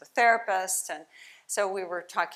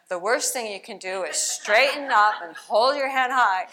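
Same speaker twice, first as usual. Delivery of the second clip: reading a cartoon line aloud, louder; 2 semitones higher, 7 dB louder than usual. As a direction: read the comic's punchline with a laugh. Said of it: "So here we have the peanuts".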